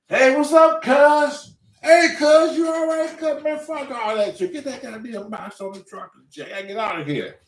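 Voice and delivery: high loud impatient cousin voice; cousin yelling. Retold or imitated.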